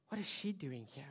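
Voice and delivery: low, soft gossiping aside; softly, under the breath; hushed onlooker murmur. Acted. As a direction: voice a quiet onlooker's question. Voices onlookers' disapproving question.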